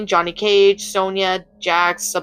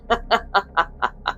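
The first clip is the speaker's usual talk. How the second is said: laughter dying away softly; fading laugh; 5 dB quieter than usual. A narrator's reading. Raiden still laughing at Fujin, quietly trailing off. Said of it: "burst into laughter".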